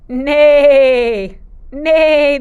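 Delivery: loud neigh like a horse; whinny out the neigh. Shown as a demonstration. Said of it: "¿Qué dice el caballo?".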